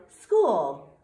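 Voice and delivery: school called out loudly; excited cheer. Acted. Little mouse arriving at school, cheering loudly. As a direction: finish the cheer loud and bright. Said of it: "said Chrysanthemum".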